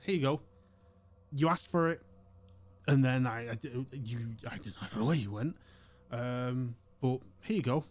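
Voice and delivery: put-on old shopkeeper handing Wyoming his book, fussy; fussy old man. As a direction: press the book on them, flustered. Old gentleman hands over book.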